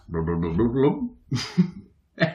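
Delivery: gurgling blub sounds imitating a stomach; stomach gurgle noise. Narrator imitating his upset stomach, gurgling blubs. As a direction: mimic a churning gut with rising blubs. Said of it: "my stomach goes".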